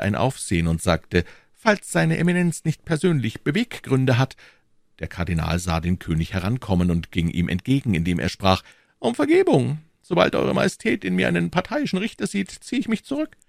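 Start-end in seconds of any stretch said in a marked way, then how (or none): 1.5-4.4 a higher royal voice; uneasy king, voiced higher; king's voice. A narrator's reading. 9.0-13.4 much higher, smooth cardinal voice; silky churchman stepping back, high voice; cardinal's voice. A narrator's reading.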